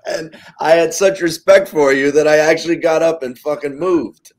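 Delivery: loud, high, disbelieving; incredulous retelling. Retold or imitated. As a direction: build the outrage. Indignant retelling.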